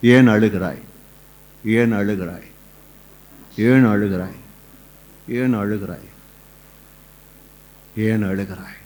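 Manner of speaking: low, hushed, consoling question; tender divine question. Acted. Voices Lord asking the weeping. (தாழ்ந்த குரலில் கனிவான கேள்வி)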